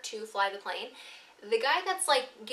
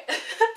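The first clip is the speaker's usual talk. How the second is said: high, louder giggle; quick giggle, much higher; 12 semitones higher, 6 dB louder than usual. Real, not acted. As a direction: laugh it off. Laughs after line.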